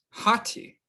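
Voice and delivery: "hati" as HAH-tee with an audible h; rough breathing. Shown as a demonstration. Teacher gives students the answer, "hati" with a breathed h. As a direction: sound the h at the start of "hati". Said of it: "rough bruising mark on the Omicron".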